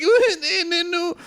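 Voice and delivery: put-on high, whiny toddler wail; mock crying kid. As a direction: wail like a little kid, hammed-up. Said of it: "Little kids explain why they're crying".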